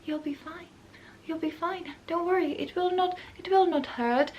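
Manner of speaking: creepy smiling reassurance. Retold or imitated. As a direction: soothe like a killer about to strike. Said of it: "with a smile on their face".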